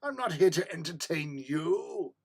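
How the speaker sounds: slow, loud haughty cat voice; voicing the cat. The cat scolds her owner, slow and loud, lightly haughty. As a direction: talk down to dad as a snooty cat.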